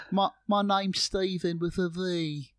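high, slow mock English accent; hammed-up English accent from the show; imitating Moon Knight accent. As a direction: quote the line in a silly English accent. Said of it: "my favourite was".

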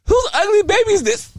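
high, astonished outburst; shocked question. Retold or imitated. Dad reacting to old baby photos, high and astonished. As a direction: act out the shock of seeing the photo.

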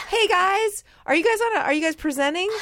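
high, perky retold greeting; friendly hail. Mom calling to girls on a show, high eager hail. Mom hailing strangers for autographs.

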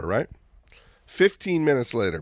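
ordinary talk, nothing marked

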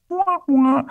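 high mock sad trombone "wah wah"; a high, deflated sad-trombone noise. Disappointed teller mimics a sad trombone, high and mocking. Cher not on the bus.

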